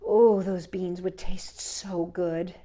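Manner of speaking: ordinary delivery, nothing marked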